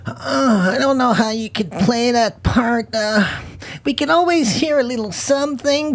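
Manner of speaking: high-pitched mock producer voice; imitating a producer. Producer turning down the francophone actress, high-pitched imitation. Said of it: "une productrice est là, puis t'as comme".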